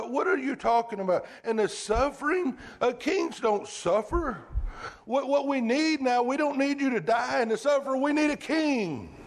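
high, insistent protest; Peter's rebuke. Retold or imitated. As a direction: kings don't suffer, said up high and pressing. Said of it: "he's rebuking Jesus".

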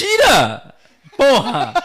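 laughing shout. Acted. Shouting the line while laughing.

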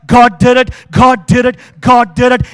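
loud rising triumphant shout. Retold or imitated. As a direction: drown him out with praise. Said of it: "This time she shouted even louder".

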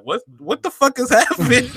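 high-pitched retold bafflement, cracking up; laughing disbelief. Retelling his reply to the cashier, high laughing disbelief. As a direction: let the quote climb and dissolve into laughter.

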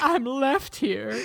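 high, loud, drawn-out fake wail; mock crying. Dorothy left behind by the wizard, mock crying. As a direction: ham up an abandoned child's wail. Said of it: "Dorothy is crying again".

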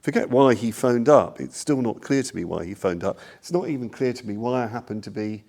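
ordinary talk

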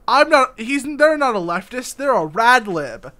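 mocking leftist impression. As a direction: parody a smug leftist. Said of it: "backwards leftist logic where it's like".

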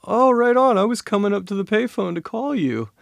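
high, loud burst of delight; excited friend imitation. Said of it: "he just got so excited".

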